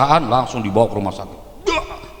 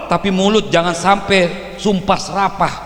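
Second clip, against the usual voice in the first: normal talking voice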